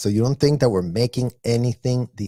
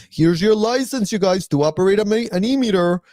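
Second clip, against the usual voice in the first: mock ceremonial; 5 semitones higher than usual. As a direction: hand over the license with fake pomp. Official handing out license.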